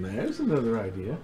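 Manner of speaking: normal talking voice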